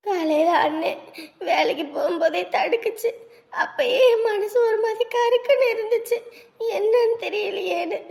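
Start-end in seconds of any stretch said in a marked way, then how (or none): none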